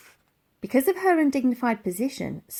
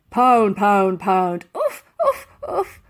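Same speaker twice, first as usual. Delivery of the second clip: bumped grunts; 6 dB louder, slower than usual. A narrator's reading. Bumped on galloping mammoth.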